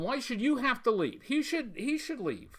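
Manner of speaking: ordinary talk, nothing marked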